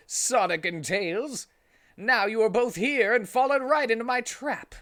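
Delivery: high, loud, menacing; gloating villain. Acted. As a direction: taunt the heroes caught in your trap. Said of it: "said the man laughing".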